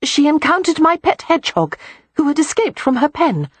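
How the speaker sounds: chatty and open; lively young woman. A narrator's reading.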